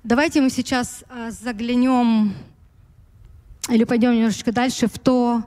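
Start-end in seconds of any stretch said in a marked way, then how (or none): none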